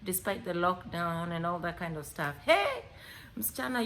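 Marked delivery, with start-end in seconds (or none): 2.4-3.9 cheering hey; excited shout. Real, not acted. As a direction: burst out with a high hey. Storyteller hyping the girl's joy.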